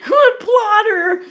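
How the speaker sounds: high, loud, slow laughing cry. Real, not acted. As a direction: gasp the name out mid-laugh. Laughing at the dog.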